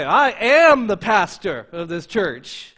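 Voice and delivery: slow, loud grand boast; proud pastor trumpeting his title; boastful proclamation. Shown as a demonstration. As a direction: puff up and declare "I am the pastor of this church" slowly and loudly. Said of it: "boasting and tooting my horn".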